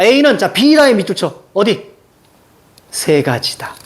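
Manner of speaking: usual speaking voice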